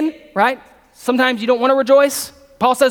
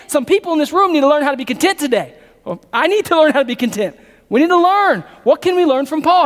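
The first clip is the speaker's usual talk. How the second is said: preaching at full force; 5 semitones higher than usual. Acted. Preacher pressing the verse on his congregation at full force. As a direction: drive the verse home to the room.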